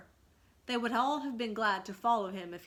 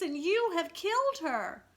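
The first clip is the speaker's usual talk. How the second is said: higher and louder, grief-struck accusation; twin's anguished cry; 9 semitones higher, 7 dB louder than usual. A narrator's reading. Twin accusing Toodles of killing the lady, anguished. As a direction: cry out that he has killed her. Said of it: "said one of the twins".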